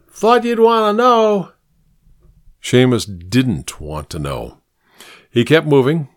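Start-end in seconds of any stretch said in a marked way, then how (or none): 0.1-1.5 much higher unsteady man's call; calling after. A narrator's reading. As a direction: toss it after him as he leaves. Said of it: "the man called out after him".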